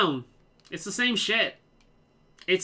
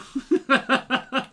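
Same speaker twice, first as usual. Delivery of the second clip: high, bursting laugh; 7 semitones higher, 4 dB louder than usual. Real, not acted. Streamer amused by the catchphrase, real laughter. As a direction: break into laughter at your own impression.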